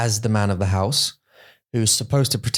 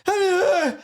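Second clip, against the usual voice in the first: mock cheery greeting. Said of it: "You go".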